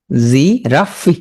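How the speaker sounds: "জিরাফি" slowly split, "জি", "রাফি"; spelling pronunciation. Shown as a demonstration. Teacher drilling spelling, slow and broken up. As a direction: pause inside "জিরাফি", sound the final "ফি". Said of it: "উচ্চারণ করবে কি".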